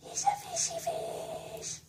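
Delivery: very high, tiny cooing voice. Real, not acted. Owner coos to the pet fish in squeaky baby talk.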